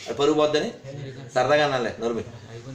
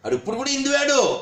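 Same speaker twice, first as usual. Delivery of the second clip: indignant; 8 semitones higher than usual. Real, not acted. Angry rant at a commenter.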